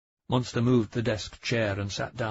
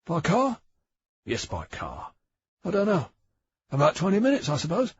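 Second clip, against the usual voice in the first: lightly voiced Bang; 8 semitones higher, 5 dB louder than usual. Bang's voice, higher and louder.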